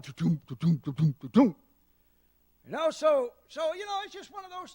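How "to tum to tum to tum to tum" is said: to-tum, to-tum tapped out steadily; imitated heartbeat. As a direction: pulse it like a healthy heart. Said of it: "should have been going".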